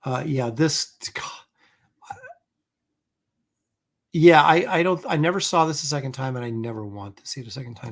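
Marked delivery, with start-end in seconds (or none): 1.1-2.2 soft whisper of dismay; whispered sigh of God; whispered groan. Real, not acted. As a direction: breathe the word out. Groans at the memory.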